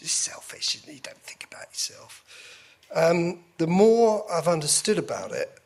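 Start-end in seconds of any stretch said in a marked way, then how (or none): 0.0-2.2 put-on hushed whisper of an old belief; imitating own thought. Voicing his first doubt to the interviewer, whispered. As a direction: whisper it like a scornful inner thought. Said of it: "which immediately I thought".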